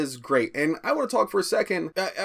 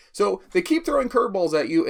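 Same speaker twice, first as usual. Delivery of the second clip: ordinary talk, nothing marked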